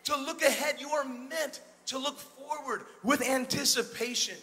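loud, rousing preacher's call to the crowd; full-force preaching. Acted. Preacher rallying his congregation, loud and fervent.

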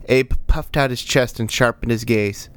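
ordinary delivery

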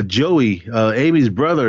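normal talking voice

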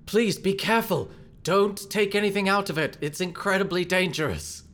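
high, urgent warning; Galahad. Acted. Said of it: "Galahad looks over your shoulder and says".

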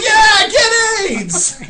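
very high, drawn-out mock holler; retold yell. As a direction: join in the heckling with a stretched-out cry. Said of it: "And I'm like".

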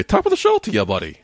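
very high, squeaky Irish greeting, dropping on the last word; cartoonish Irish lilt, sky-high pitch; mock Irish accent. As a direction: put on a bad falsetto Irish accent. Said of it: "supposed to be your Irish accent".

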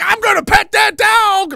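high, loud mock little-girl threat; feisty kid threat. A little girl facing a bear, high loud defiant threat. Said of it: "start being like".

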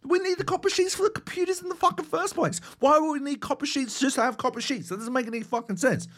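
real disbelief, throw your hands up; exasperated outburst. Real, not acted. Exasperated rant about copper sheets.

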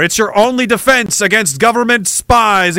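ordinary delivery

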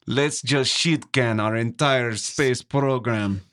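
slow, drawn-out mock Russian accent. Answering a comrade about the space program, slow Russian accent. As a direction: play a dismissive Russian comrade. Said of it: "you said it with a better accent".

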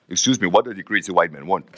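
a lightly voiced bewildered villager; puzzled African man. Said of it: "die Afrikaan kijkt helemaal verbaasd". (licht nagedaan verbaasde Afrikaanse man)

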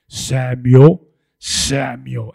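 very low, loud, booming call; voice of God calling. Acted. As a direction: call the boy's name as a deep, mighty voice. Voices the Lord calling young Samuel.

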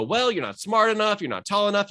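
discouraging head voice. Retold or imitated. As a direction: talk the dream down like a doubting inner critic. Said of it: "talking from your head voice and go".

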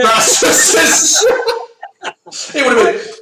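high-pitched words broken by laughing; too tickled to finish the sentence; helpless laughter. Real, not acted. Co-host picturing a comic as pit lane reporter, helpless laughter.

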